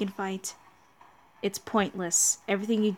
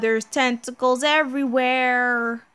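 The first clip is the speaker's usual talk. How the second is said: ordinary talk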